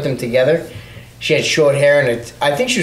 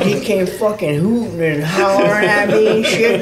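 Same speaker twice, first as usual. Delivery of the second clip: higher put-on buddy voice, amused; Joe Kidd accent; 7 semitones higher than usual. Buddy recounts being hollered at, high mimicked accent. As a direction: imitate your friend's accent, pitched well up. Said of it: "That's a pretty good Joe Kidd accent, too".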